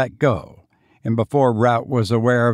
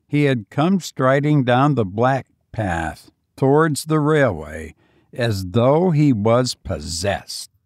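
ordinary delivery, nothing marked

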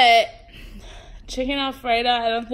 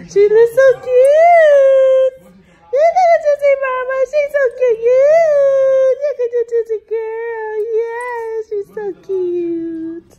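very high, loud, slow gushing squeal; stretched high coo, near tears of delight; 13 semitones higher, 10 dB louder, slower than usual. Real, not acted. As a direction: squeal over how cute she is. Gushing over Tootsie in dress.

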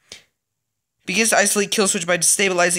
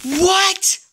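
high, loud shocked yelp; disbelieving; 4 dB louder than usual. Real, not acted. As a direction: cry out in disbelief. Cure rising, disbelief.

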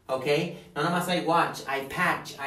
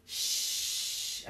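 long voiceless "shh"; isolated sh sound; 5 dB quieter than usual. Shown as a demonstration. Teacher isolating how push ends, long voiceless sh. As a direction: hold a hissed sh.

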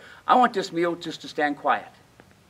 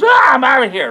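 shouted, higher horse voice, bolting; flighty horse; 6 semitones higher, 7 dB louder than usual. Retold or imitated. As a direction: play a skittish horse yelling as it bolts off. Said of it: "he goes".

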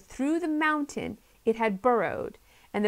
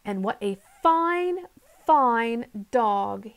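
plain reading voice

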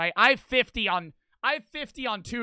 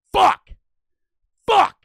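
sudden, much higher and louder frustrated curse; angry outburst; 11 semitones higher, 13 dB louder than usual. Retold or imitated. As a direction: explode in a furious yelled curse, twice. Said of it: "like, getting mad and shit".

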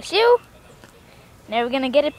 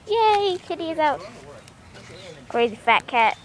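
ordinary delivery

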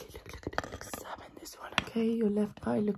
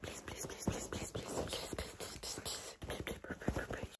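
out of breath; 9 dB quieter than usual. Real, not acted. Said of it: "Can't even speak out of breath".

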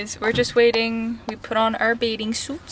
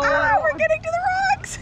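high excited cry; faster than usual. Real, not acted. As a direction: call out in alarm. Tube nearing the rocks.

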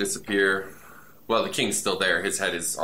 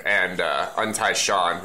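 normal talking voice

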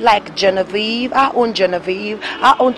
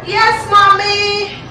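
high, drawn-out call to mom; answering a parent from another room; 10 semitones higher, 6 dB louder, much slower than usual. Acted. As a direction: shout back slowly across the house. Calls back to her mother.

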